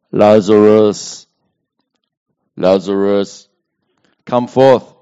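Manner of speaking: slow, booming low call; loud commanding cry. A narrator's reading. Jesus summoning dead Lazarus from tomb, loud drawn-out command. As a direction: call out loudly, drawing out the name. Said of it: "he cried with a loud voice".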